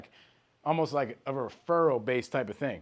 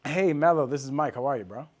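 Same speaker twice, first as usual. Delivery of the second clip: super welcoming; 4 dB louder than usual. Shown as a demonstration. Salesman phoning Mello like a friend, super welcoming. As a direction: greet them like your homie. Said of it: "You see how that's so welcoming?".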